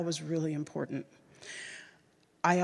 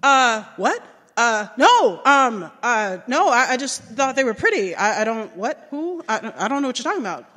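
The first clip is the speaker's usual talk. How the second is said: higher, louder stammering panic; flustered; 4 semitones higher, 9 dB louder than usual. Retold or imitated. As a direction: stammer like you've been caught. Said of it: "immediately I was like".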